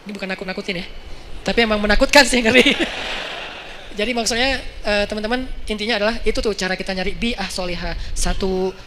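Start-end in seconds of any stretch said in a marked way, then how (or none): 2.8-3.9 real, unvoiced trailing laugh. Real, not acted. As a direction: laugh it off after the scary bit. Laughs after admitting it's scary.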